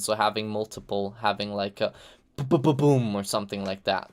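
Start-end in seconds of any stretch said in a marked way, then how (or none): 2.4-3.2 rapid popping stutter into a boom; stuttered explosion noise, punched out; imitated staggered explosion. Shown as a demonstration. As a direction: mouth a string of quick blasts.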